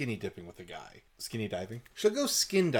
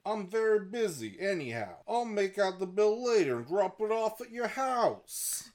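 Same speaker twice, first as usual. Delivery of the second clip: put-on high-pitched mechanic; mechanic character voice; 10 semitones higher than usual. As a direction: play a busy mechanic in a high cartoon voice. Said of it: "the mechanic said".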